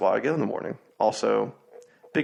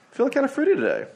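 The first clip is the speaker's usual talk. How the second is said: teasing; much faster than usual. Real, not acted. Teasing remark about fruity beers.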